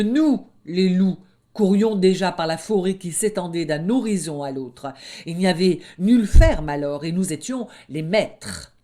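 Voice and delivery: loud, proud wolf cub lording it; boastful young wolf. Acted. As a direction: puff yourself up over the little lamb.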